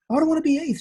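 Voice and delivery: put-on high, loud childish whine of protest; hammed-up complaint to his mother; mock whiny protest. Replying to mom who says be eighth, mock whiny protest. Protesting mom's advice, retold.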